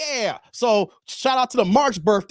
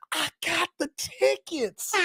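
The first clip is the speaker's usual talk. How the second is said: high, slow, softer teasing brag; gloating; 8 semitones higher, 4 dB quieter, slower than usual. Real, not acted. Bragging to chat about tickets, high slow gloat. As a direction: rub it in playfully.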